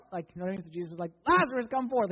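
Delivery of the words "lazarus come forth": high, loud mock shout; booming acted summons, pitch raised. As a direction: shout it as if to someone hard of hearing. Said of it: "Like, he couldn't hear him".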